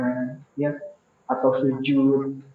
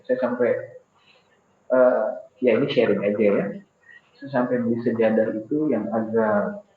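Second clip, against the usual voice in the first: ordinary talk, nothing marked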